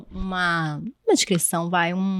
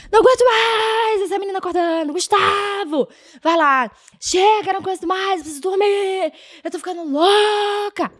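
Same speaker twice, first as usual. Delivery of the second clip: high, frantic exhausted mother; frazzled mom outburst; 10 semitones higher than usual. Retold or imitated. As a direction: snap like a sleepless mom at her limit. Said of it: "Eu surtava".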